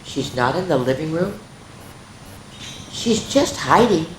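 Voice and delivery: a touch of anxious mother. Acted. Anxious mother answering father about missing Medi, mildly worried. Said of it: "She answered in an anxious voice".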